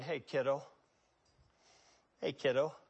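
put-on gently coaxing a grown man like a child; tender kiddo. Jesus softly calling the paralyzed man kiddo, tender. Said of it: "that's the way I would say it".

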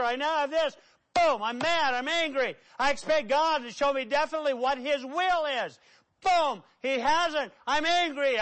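high, forceful, mounting indignation; heated preaching rant. Acted. Preacher acts out someone angry at God, heated rant. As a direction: rail through a list of letdowns.

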